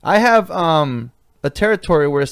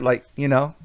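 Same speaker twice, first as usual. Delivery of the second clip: ordinary talk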